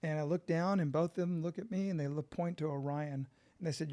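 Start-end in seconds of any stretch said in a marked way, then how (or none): none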